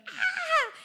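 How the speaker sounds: very high short scream; hammed-up demon yelp; mock demon shriek. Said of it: "the demon would be like".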